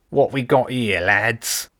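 a guard rat jeering at newcomers, sneering. Acted. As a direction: sneer it down at the intruders. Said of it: "one of the rats sneered".

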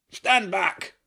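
high, sharp roar of warning; roaring tyrant king. A narrator's reading. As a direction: bellow it like a king protecting the group. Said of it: "the king roared".

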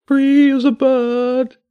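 high, slow bird voice, dazed; a stunned pigeon mumbling its catchphrase, high and slow. Acted. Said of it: "it said in a dazed voice".

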